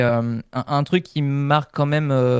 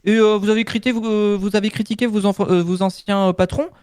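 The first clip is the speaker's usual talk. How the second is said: aggrieved company voice; 7 semitones higher than usual. Retold or imitated. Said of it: "qui ont dit".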